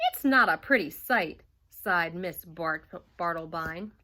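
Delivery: usual speaking voice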